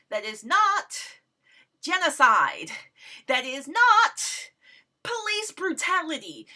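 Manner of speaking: every not punched slow and loud; emphatic not. Real, not acted. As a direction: stress each not. Listing what it is not.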